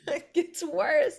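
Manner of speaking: high, loud and slow through a laugh. Real, not acted. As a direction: laugh out a teasing warning. Laughs while teasing more drama.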